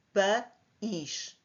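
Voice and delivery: "Pa-is" split into two separate syllables. Shown as a demonstration. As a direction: say "Pa-is" with a gap before a stressed "is". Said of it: "pertencem a sílabas diferentes".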